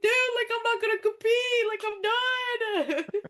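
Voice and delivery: mock-tearful wail of a wrestler quitting; imitating crying. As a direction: retell your sobbing self, half-laughing. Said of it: "why are you crying?".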